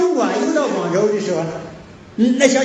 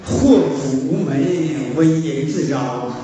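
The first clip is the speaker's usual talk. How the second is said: slow proverb recitation; 6 semitones lower, slower than usual. Real, not acted. Preacher intoning proverb.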